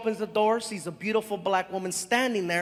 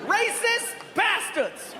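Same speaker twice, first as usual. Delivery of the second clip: outraged yell; 10 semitones higher, slower than usual. Retold or imitated. Man yelling through the door, stretched outraged cry. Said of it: "we heard".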